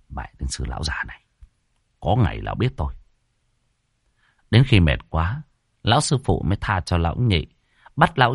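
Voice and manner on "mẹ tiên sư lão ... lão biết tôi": much lower, softer, fast muttered cursing; grumbled curse. Acted. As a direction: curse under your breath while fleeing. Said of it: "lầu bầu chửi".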